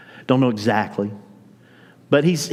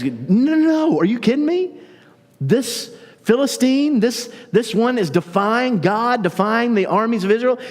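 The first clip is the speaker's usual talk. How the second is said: high, indignant cry of disbelief; outraged disbelief; 11 semitones higher than usual. Retold or imitated. Young David protesting the giant's insult, in high outraged disbelief. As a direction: sound scandalized that anyone would defy God.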